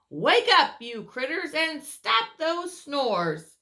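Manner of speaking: loud high wake-up call; sing-song call to wake him up. Acted. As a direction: rouse the sleeper with a cheery shout. Character calls sleeper awake.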